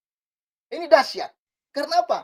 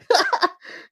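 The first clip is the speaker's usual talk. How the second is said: short amused laugh; 7 semitones higher than usual. Real, not acted. Laughs at netizen comment.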